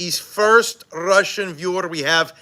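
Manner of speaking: slow put-on Russian accent; slow, hammed-up Russian accent; mock Russian accent. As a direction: drag it out in a playful fake Russian accent. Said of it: "how is my Russian accent".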